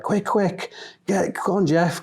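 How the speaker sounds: high, quick egging-on call; mock-urgent hurry up; urging Jeff on. Coworkers egging Jeff to chase a shoplifter, high urgent call.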